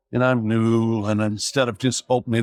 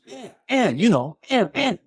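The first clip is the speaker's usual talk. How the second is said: high, mumbled mock grunts of eh; imitating mumbling suspect; 12 semitones higher than usual. Suspect mumbling his middle name to the officer, in a high mock voice. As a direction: ham up a suspect who can't get the word out.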